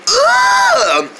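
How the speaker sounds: long, high "ugh"; disgusted groan. Acted. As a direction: draw out the revulsion. Disgust at hearing of onions.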